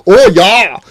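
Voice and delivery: loud, high, hammed-up South African accent; over-the-top South African. Film fan mocking an actor's awful accent, over-the-top South African. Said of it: "the most over the top South African".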